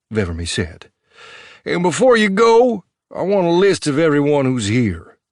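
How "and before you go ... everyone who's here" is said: sharp, raised lawman voice; high, loud officer demand. Acted. Officer demanding a list from Marv, raised and sharp. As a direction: bark the demand from above.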